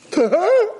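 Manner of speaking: very high shocked question; horrified disbelief. Acted. Kitchen boy learning who the bride is, horrified disbelief. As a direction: squeal it back as an appalled question.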